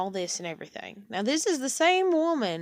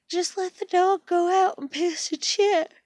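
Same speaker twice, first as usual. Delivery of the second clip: exasperated mock quote; 10 semitones higher, 7 dB louder than usual. Mocking grandmother's lax dog rule.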